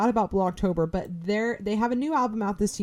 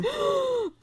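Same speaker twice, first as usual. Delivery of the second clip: high, sharp gasp; delighted gasp. Real, not acted. Host hears friend played the whole album, delighted gasp. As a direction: gasp in excited surprise.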